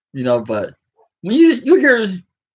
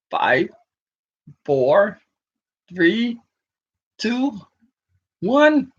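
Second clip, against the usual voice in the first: countdown climbing higher each number; rising countdown; 5 semitones higher, much slower than usual. Retold or imitated. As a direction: build the countdown up. Mock countdown.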